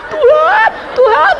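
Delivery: very high, loud pleading calls; desperate cry. Retold or imitated. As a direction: cry out to God in terror. Desperate crying to God. (berseru panik kepada Tuhan dengan suara tinggi)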